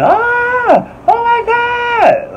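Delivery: very high, drawn-out falsetto scream; slow, high-pitched mock shriek; imitated screaming. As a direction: mimic a panicked victim shrieking. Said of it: "and she just screaming".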